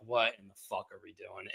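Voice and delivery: low, hushed retold bafflement; quiet disbelief. Fan recalling his reaction to Rasheen Ali coming out, quietly baffled. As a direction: say it under your breath, baffled.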